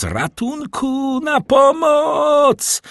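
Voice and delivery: very high, drawn-out call; cry for help. A narrator's reading. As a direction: scream for help, stretching each word. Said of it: "wołając". (przeciągłe, wysokie wołanie o pomoc)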